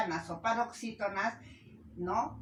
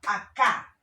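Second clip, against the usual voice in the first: "acá" with a loud last syllable, a-CÁ; final-syllable stress; 9 dB louder than usual. Shown as a demonstration. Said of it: "la fuerza de voz está en la última".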